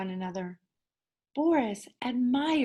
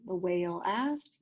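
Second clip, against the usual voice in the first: ordinary delivery, nothing marked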